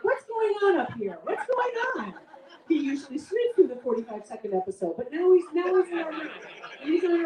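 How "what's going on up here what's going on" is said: high-pitched mock dog voice; puzzled little dog, voiced high. Little dog questioning the couple on the bed, high mock alarm. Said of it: "The little dog is like".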